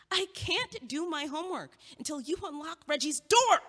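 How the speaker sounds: a fed-up plea that climbs in volume; exasperated demand. Acted. Roommate blocked from her textbook, demanding he unlock the door, exasperated and loud.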